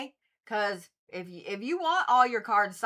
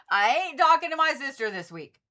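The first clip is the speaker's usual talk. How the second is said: higher and louder, stressing talking; emphatic declaration; 6 semitones higher, 6 dB louder than usual. Real, not acted. To viewers about her Aquarius sister, loud and emphatic. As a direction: declare it loudly like a firm vow.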